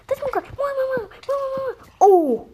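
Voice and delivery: high, loud repeated calling; calling out loudly to the dog; excited yelling. Real, not acted. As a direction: shout it out in a burst.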